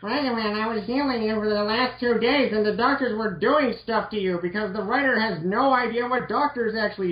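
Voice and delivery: a hint of an indignant Roll; voicing Roll.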